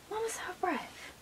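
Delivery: high whispered aside; hushed aside. Real, not acted. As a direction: whisper it as a secret.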